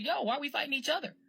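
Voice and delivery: yo, lively and quick; excited realization. Retold or imitated. Caribbean Americans calling to African Americans, excited and quick. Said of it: "getting together and said".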